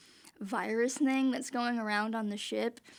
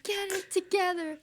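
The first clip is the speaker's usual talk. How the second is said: mock Kirk; 9 semitones higher than usual. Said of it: "Just like".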